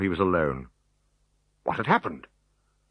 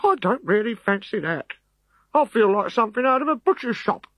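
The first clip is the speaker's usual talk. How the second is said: high, complaining soldier voice; a soldier balking at orders; reluctant legionary. Acted. Legionary objecting to stuffing, high and complaining.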